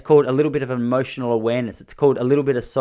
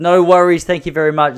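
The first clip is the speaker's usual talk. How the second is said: bright loud thanks; 5 semitones higher, 8 dB louder than usual. Retold or imitated. Cheerful reply to being kicked out.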